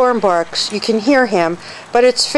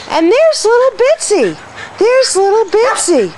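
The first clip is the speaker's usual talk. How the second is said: cooing pet talk; 12 semitones higher than usual. Real, not acted. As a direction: coo like you're greeting a puppy. Cooing over tiny dog.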